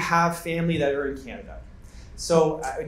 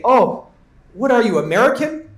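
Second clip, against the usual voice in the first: higher, louder put-on voice of a foreigner; mock suspicious foreigner; 5 semitones higher, 7 dB louder than usual. Foreigners at the border quizzing a traveller, in a louder mock voice. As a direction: play the foreigner jumping to conclusions. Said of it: "And they say".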